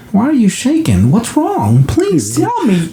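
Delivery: high pleading mom, slowing on please tell me; mock worried mother begging, pitched high; panicking mom. Mother clutches her shaking son, high panicked plea. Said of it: "She's panicking".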